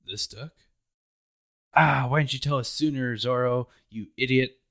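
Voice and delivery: mock exasperation, hammed-up; exasperated yell. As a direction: yell like you just learned this too late. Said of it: "he says".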